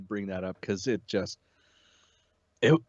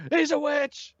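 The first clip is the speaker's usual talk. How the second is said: high, shrill-pitched accusing cry; mock villager accusation; 4 dB louder, slower than usual. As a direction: point and accuse like a mob villager. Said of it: "It's just gonna be like".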